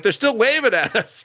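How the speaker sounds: very high, hammed-up imitation; mock excited report. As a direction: exclaim it in a high put-on voice. Companion reports excitedly in retold scene.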